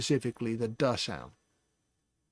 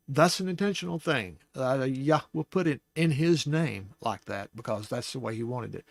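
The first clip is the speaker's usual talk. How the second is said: ordinary talk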